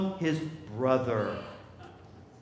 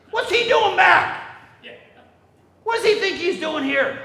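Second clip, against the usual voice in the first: loud, high and outraged; indignant shout; 11 semitones higher, 5 dB louder than usual. Acted. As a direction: yell it as the jealous older brother. Older brother furious at the return.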